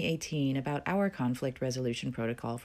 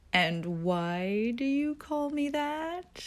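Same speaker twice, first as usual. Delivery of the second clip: much higher, slow syrupy coo; sweeter cutesy voice; 10 semitones higher, much slower than usual. Retold or imitated. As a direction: lay the sweetness on thick. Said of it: "still in a cutesy voice, perhaps even sweeter".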